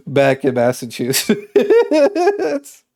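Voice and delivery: words breaking into laughter; helpless laugh takes over the last word; cracking up. Real, not acted. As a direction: start laughing mid-phrase, drawing it out. Cracks up at own joke.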